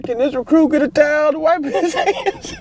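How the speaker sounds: pitched far up, cracking with laughter; can barely get the words out for laughing; very high laughing plea. Retold or imitated. Recruit asking for a towel to wipe his hands, squeaky laughing.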